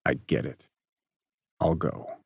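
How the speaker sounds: low, soft, resigned; hurt boy agreeing to leave; defeated. Acted. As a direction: give up quietly. Parker hurt, leaving.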